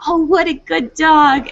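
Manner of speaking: high, gushing praise, stretched out slowly; lavish a good dog with sing-song praise. Shown as a demonstration. Trainer showing owners how to praise a barking dog, high and gushing. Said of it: "as soon as they're quiet".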